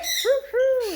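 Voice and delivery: high hooting whistle; whistle imitation. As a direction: mimic the bird's hoots up high. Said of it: "And he went".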